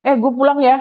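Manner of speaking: bright, chirpy casual goodbye. Retold or imitated. Lecturer voicing a friend leaving, chirpy. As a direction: toss off a quick "gue pulang".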